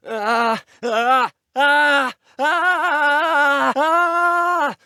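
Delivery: repeated high, loud screams; loud high cries building to a long scream; rising screams. Acted. Character cries out in game action.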